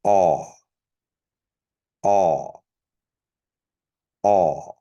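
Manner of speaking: Aw said as p'aw with a popped p; ejective P. Shown as a demonstration. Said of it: "And then the ejective P".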